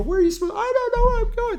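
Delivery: put-on high little-kid voice, rising; a small child voiced high and bewildered; lost kindergartner. Wandering kindergartner asking the sub, high confused kid voice. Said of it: "they go".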